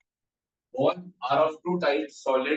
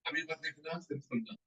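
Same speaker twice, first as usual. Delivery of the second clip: much softer, almost under the breath; quiet aside; 12 dB quieter than usual. Real, not acted. Teacher softly telling students to just listen, quiet. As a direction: drop the voice to settle them.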